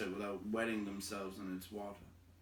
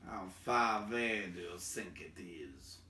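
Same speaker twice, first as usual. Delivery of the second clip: gruff dwarf voice, lower; a steady, lower dwarf question; Thorin, gruff and low; Thorin asking; 3 semitones lower than usual. Acted. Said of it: "asked Thorin".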